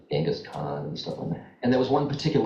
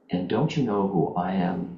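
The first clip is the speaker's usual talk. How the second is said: ordinary talk, nothing marked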